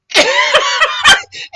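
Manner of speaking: loud shrieking laugh; high squealing laugh. Real, not acted. Laughing at own story.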